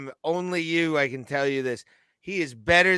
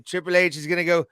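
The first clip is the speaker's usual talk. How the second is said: ordinary talk, nothing marked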